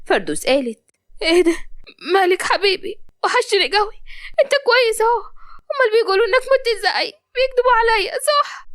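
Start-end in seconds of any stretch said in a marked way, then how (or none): 1.2-8.7 very high-pitched, emotional disbelief; overwrought joy. Acted. Jailed mother thinks she sees dead Malik, overwrought joy. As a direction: climb higher as hope takes over.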